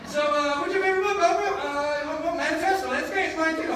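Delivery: high, loud and giddy, playing both sides of a pretend talk; bubbling with excitement, half laughing; giddy pretend interview. Rehearsing with Oprah's photo on the mantle, giddy and loud.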